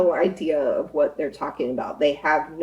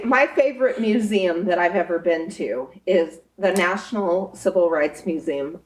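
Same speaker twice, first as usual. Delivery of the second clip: ordinary delivery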